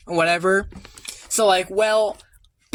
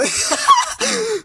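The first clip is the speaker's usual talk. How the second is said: squealing laugh, much higher; high excited laugh. Real, not acted. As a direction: burst into a giddy laugh.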